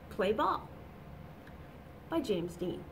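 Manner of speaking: ordinary delivery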